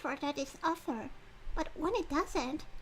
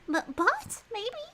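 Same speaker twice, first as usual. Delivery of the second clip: flustered high squeak; 10 semitones higher, 5 dB louder than usual. Real, not acted. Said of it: "flustered self-questioning".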